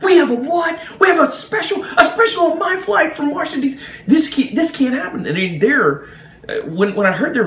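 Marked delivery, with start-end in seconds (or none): 0.0-5.2 hammed-up high, squawking outrage; ticked-off pilots. Pilots hear of a special on their flight, high mock-angry squawk. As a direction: imitate irate voices yelling down a phone line. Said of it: "the pilots are ticked".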